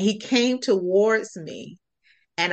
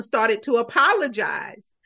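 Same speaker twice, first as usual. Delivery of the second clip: ordinary delivery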